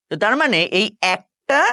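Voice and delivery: ordinary delivery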